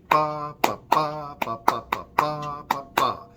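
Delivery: offbeat ba syllables; teacher showing a syncopated rhythm. Shown as a demonstration. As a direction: chant the ba beats off the beat. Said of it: "That would be a syncopated rhythm".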